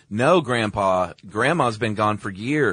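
loud, drawn-out mock correction; mock correcting Grandpa. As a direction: stretch it out loudly, as if correcting Grandpa. Voicing a blunt correction of grandpa.

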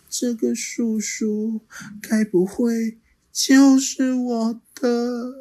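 high, slow, hushed wonder; wondering child. Acted. Little dinosaur wondering about the T-rex, high and slow. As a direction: let the thought dawn slowly.

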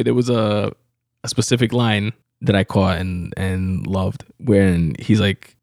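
ordinary talk, nothing marked

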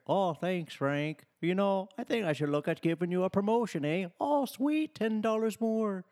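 mock Canadian boss. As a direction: play a jolly Canadian boss with a Canadian accent, fast. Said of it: "That's not a Canadian accent. That is Irish".

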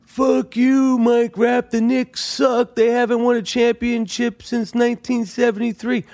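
imitating fans heckling him; mocking fans. Fans heckling the podcaster about the Knicks. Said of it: "I know you guys are going".

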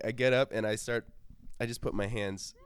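ordinary talk, nothing marked

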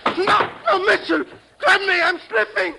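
high, crying plea for help; panicked plea. Acted. Thug slipping from the cable car, begging in panic. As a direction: beg him as you lose your grip.

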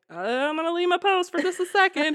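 high, stagey guard's announcement; pointedly loud announcement. Acted. Guard signalling an inside job, pointedly loud. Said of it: "say it really loudly".

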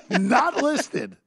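laughing through the words; amused, laughing answer. Real, not acted. Laughs at joke.

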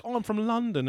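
high, put-on Cockney voice; playful fake London accent; mock London accent. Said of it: "he doesn't say, like".

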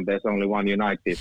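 ordinary talk

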